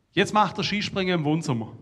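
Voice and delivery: loud, high call back; shouted answer. Retold or imitated. The player's wife shouting back to the guest, loud. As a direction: shout the explanation across the room. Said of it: "Dann schreit die Frau von dem".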